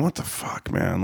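breathy whispered muttering to himself; whispered self-reproach. Retold or imitated. Comic alone in his car, whispering to himself. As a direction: whisper it, disgusted with yourself. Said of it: "drove home going".

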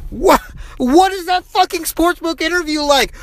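high-pitched indignant rant, pitch climbing well above normal; incensed rant. Real, not acted. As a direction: rant at the absurdity, voice pushed way up. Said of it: "I'm incensed".

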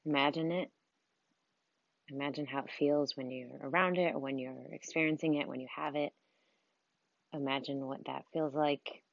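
soft, unhurried meditation voice with long pauses; guided meditation. Acted. Coach leads listeners through breathing and visualizing their dream life, guided meditation. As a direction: guide them gently through breathing and imagining, softly.

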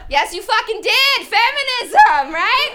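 loud, high and proud; high triumphant yell, dragging out feminism; triumphant shout. Real, not acted. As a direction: yell it like a victory cry.

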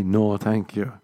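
ordinary talk

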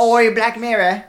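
loud, slow hammed-up Cockney accent; hammed-up Cockney accent, high and loud. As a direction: bark it like a Cockney lad. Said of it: "couldn't do a Cockney accent".

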